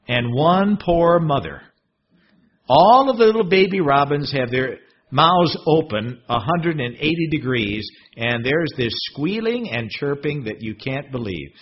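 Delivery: ordinary talk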